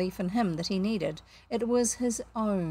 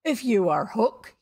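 slow, louder appeal, a touch humble; almost humble; 6 dB louder than usual. A narrator's reading. Captain tries ingratiating manner on stranger, almost humble. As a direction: try ingratiating, still forceful. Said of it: "he said almost humbly".

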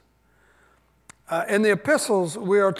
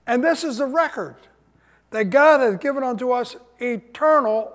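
scripture reading; 3 semitones higher, 3 dB louder than usual. Acted. Pastor proclaiming the verse to his church, raised and ringing. Pastor reads First John aloud.

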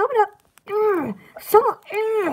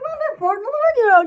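imagined listeners' reaction; 6 semitones higher, faster than usual. Retold or imitated. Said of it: "They're like".

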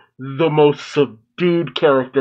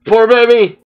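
louder and higher, pained sympathy; loud pitying cry; 8 semitones higher, 7 dB louder than usual. Real, not acted. Watching a cornered character, a loud pitying cry. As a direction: cry out for the poor thing.

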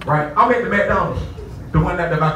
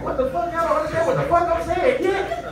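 higher, frustrated disbelief; exasperated; 11 semitones higher than usual. Retold or imitated. Guest frustrated that hotel staff won't understand, higher exasperation. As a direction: throw up your hands at them. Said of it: "So now I'm like".

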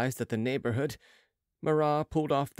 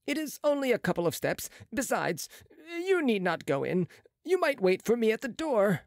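higher, brisk surgeon's voice; a proud young surgeon, pitched up; Marat's voice; 10 semitones higher than usual. A narrator's reading. Marat voice continues.

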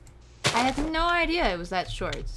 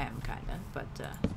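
ordinary talk, nothing marked

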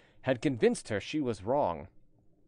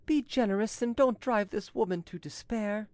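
higher-pitched, earnest appeal; pleading tone; 9 semitones higher than usual. A narrator's reading. Chevalier begs his uncle for mercy, pleading. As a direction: plead on her behalf in a raised voice. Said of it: "said the Chevalier in a pleading tone".

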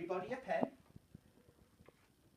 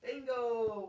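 big voice; 7 semitones higher than usual. Shown as a demonstration. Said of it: "Big voice".

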